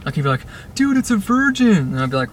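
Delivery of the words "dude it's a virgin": high-pitched imitation of a bully's call; jeering mock yell, pitched way up. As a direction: announce it mockingly to everyone around. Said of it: "yell out the stupidest things".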